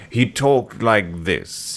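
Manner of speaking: very slow, droll drawl; deadpan caricature voice; Alfred Hitchcock impression. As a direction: stretch each word like a macabre host. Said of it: "living caricature of himself".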